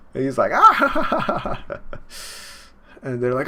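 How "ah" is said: high, loud staccato mock cackle; acted maniacal laugh. Monkey King after his punch, loud high cackle. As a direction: burst into a hammed-up, very high laugh.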